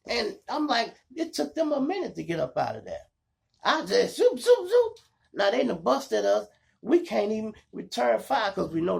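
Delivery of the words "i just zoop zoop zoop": high loud zoop noises; zooming sound effect. Retold or imitated. To his listeners, loud high zoop car sounds. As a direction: fling out each zoop like a swerving car. Imitating speeding away.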